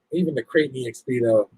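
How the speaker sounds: normal talking voice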